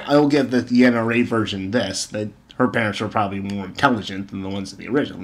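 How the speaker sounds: ordinary delivery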